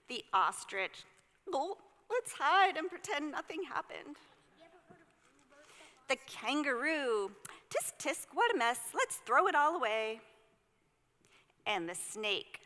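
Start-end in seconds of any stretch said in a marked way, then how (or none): 1.5-4.2 higher, gulping ostrich voice; a lightly voiced nervous ostrich. Ostrich in the story urging everyone to hide, nervous voice. 7.7-10.2 higher, louder tutting kangaroo; tut-tut like a fussy kangaroo. Acted. Kangaroo in the story scolding the mess, fussy tutting voice.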